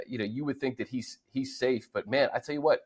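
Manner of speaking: ordinary talk, nothing marked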